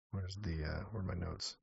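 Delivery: ordinary delivery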